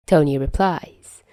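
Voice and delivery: ordinary delivery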